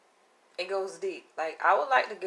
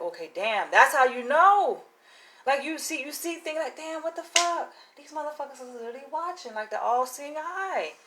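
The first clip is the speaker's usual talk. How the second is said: raised, startled inner voice; shocked realization; 7 semitones higher than usual. Retold or imitated. Realizing they are being watched, raised and startled. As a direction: gasp it out as the truth hits. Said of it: "you're like".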